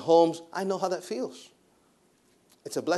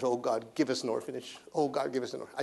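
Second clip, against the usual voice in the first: softly re-enacted plea to God; quiet, repeated prayer, retold; 3 semitones lower, 8 dB quieter than usual. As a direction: pray it low and soft. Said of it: "I prayed for ten years".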